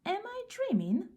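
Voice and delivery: can't believe his eyes; amazed. A narrator's reading. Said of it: "stopped in amazement".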